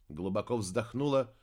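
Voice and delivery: ordinary delivery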